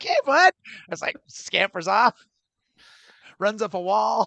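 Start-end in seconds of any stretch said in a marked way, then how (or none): 0.0-0.5 much higher-pitched excited hello; excited greeting. Acted. Greeting a lizard you've just spotted, excited. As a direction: perk up high, greeting a little critter. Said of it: "you get pretty excited".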